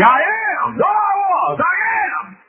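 very high, loud cry; preacher's shout. Real, not acted. Preacher proclaims the living God, exultant sermon shout. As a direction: belt it out at the top of the voice.

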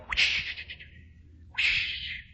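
imitated whoosh of passing cars; voiceless swish of wind; whooshing coattail. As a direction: blow out a rushing whoosh. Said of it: "my coattail going".

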